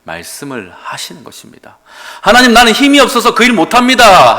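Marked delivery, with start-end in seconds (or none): 2.2-4.4 loud, higher whining excuse; pleading excuse. Retold or imitated. Moses whines to God, loud and high. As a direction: complain that you can't. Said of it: "할 때".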